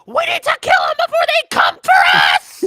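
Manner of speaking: very high shouting rodent voice; guinea pig voice. Pretend. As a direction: squeak out a call to arms. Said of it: "That's a really good guinea pig voice".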